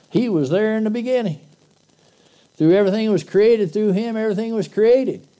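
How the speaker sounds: put-on high, loud Southern-accented preaching voice; Jesus with a Southern accent. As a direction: proclaim scripture as a Southern Jesus. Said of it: "That's how I hear him talking".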